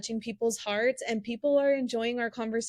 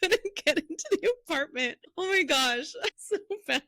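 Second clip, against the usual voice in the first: real helpless laughter, high-pitched and loud; laughing; 8 semitones higher, 4 dB louder than usual. Real, not acted. Podcast host confessing she chained her fiance out, laughing hard. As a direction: squeeze the words out through a laughing fit. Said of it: "I'm just laughing 'cause that's so funny".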